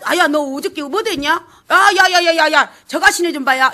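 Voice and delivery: put-on loud, quick Jeolla country-girl banter; Jeolla dialect chatter. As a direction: call out in broad Jeolla dialect. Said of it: "이런 말들이 실제 춘향가에 나오더라고요".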